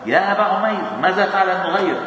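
a light mock sing-song to a child; playful teasing of a child. Teasing a little boy.

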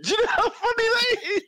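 high, quick and laughing; laughing fast question. Real, not acted. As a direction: rush it out through laughter.

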